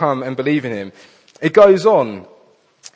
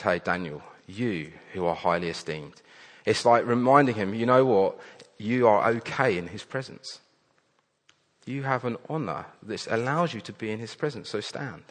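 softer, gentle angel reassuring a frightened man; tender reassurance; 2 semitones lower, 6 dB quieter than usual. Acted. Angel comforting a fallen Daniel, soft and tender. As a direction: hush it down like comforting someone trembling.

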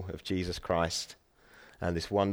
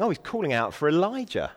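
higher-pitched mock surprise; 7 semitones higher than usual. Bystanders jeering at the man on the cross, mocking. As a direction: jeer lightly, pitched up on the opening. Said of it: "really mocking him".

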